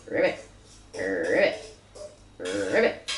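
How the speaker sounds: deep croaking ribbit; very low playful ribbit croaks; frog croak. Acted. Parent voicing frog.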